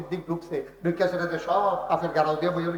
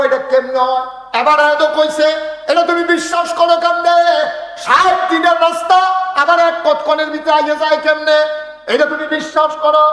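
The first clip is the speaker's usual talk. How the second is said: high, loud, hammering challenge; incredulous shouting; 11 semitones higher, 9 dB louder than usual. Acted. Abu Jahl challenges Abu Bakr's belief, high shout. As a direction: shout each question in disbelief.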